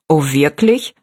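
low-pitched, understated astonishment; surprised. A narrator's reading. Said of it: "sagte die Frau erstaunt".

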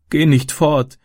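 high, pleading tearful voice; acted child's sobbing plea. A narrator's reading. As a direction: beg him to stay, in tears. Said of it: "schluchzte sie".

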